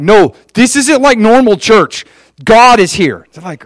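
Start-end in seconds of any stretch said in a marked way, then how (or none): 0.0-2.1 loud, high, drawn-out protest; buzzing insistence. Acted. Urging family to come to church, buzzing insistence. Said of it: "they were so buzzing". 2.4-3.3 loud, higher declaration; buzzing insistence. Acted. Telling doubtful family who showed up, buzzing insistence. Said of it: "they were so buzzing".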